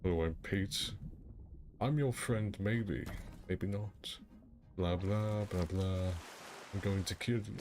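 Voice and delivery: lightly voiced menacing villain; a hint of a sinister villain; character voice. Shown as a demonstration. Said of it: "with my Peyton, ... a scuffed version of my Darth Maul".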